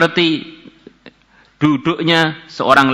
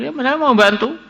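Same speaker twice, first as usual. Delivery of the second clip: much higher, a man bargaining coolly; Kifl's voice; 13 semitones higher than usual. Acted. Kifl answers the woman's plea, voiced higher. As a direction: voice Kifl setting his price.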